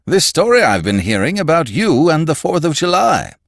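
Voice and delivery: high, loud Gowler figure, probing; blustering magazine boss. A narrator's reading.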